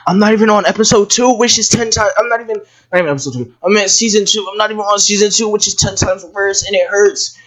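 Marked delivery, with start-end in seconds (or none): none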